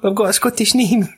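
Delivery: high put-on American voice; mock American accent. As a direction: pitch it high, faking American. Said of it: "so it's like that, but it's proper American".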